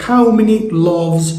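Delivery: a baker's question, said very slowly; slow modelled question. Acted. Baker asking a customer how many loaves, slowly. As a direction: ask it slowly and clearly, twice. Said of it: "أنت رايح للراجل بيسألك".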